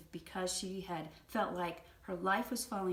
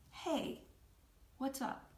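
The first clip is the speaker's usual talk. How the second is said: higher, tentative reply; wary, hopeful; 6 semitones higher, slower than usual. Retold or imitated. Husband answering his accusing wife, warily hopeful. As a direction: answer carefully, with a little hope. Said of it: "a little bit of wariness and a little bit of hopefulness".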